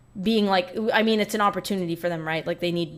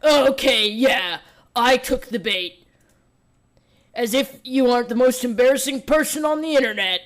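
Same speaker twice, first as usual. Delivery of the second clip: louder, higher mock indignant snap; hammed-up annoyed YouTuber; mock angry rebuttal; 4 semitones higher, 6 dB louder than usual. YouTuber pretending he isn't mad, hammed-up annoyed reply. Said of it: "He was like".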